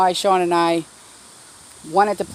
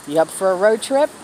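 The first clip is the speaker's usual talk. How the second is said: imitating Aunt Dawn; 2 semitones higher, 5 dB louder than usual. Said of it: "what does Aunt Dawn say".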